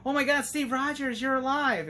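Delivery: thrilled to find him alive; excited reunion. Pretend. Johnny Storm greeting Steve Rogers, gushing and thrilled. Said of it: "And then be like".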